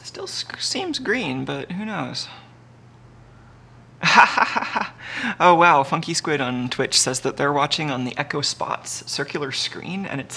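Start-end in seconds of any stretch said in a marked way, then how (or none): 4.0-5.3 loud, high burst of laughter; real laugh, higher and louder than the talk; amused laugh. Real, not acted. Streamer reacting to a viewer's message, amused laugh.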